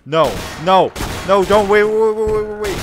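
high, loud panicked yelling; panicked shout. Real, not acted. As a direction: shout frantically to stop. Panicking in game.

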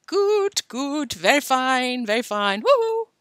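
lightly loony, drawn-out words; mock-crazy chanting with a high woo-hoo; crazy boy act. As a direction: play crackers, ending on a high whoop. Hopping boy acting crazy.

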